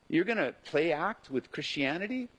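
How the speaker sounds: ordinary delivery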